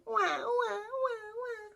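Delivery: high wailing wah; mock baby cry. Shown as a demonstration. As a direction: wail like a little baby. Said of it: "A baby crying".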